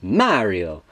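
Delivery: drawn-out name with a swooping first syllable; Mario. Retold or imitated. Demonstrating how the character says it, mild impression. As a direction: sing out MAH-rio like the game character. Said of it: "based upon the way Mario says it".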